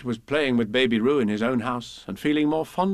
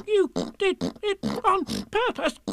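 slow, high squeak broken by splutters; spluttering; slower than usual. A narrator's reading. Piglet with a mouthful of soap, spluttered squeal. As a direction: splutter each word through soapy water. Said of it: "spluttered Piglet".

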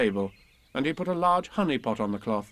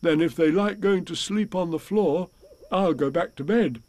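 lightly voiced storybook bear, a little higher; reply as a mild bear, slightly raised in pitch; Pooh; 5 semitones higher than usual. A narrator's reading. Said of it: "said Pooh".

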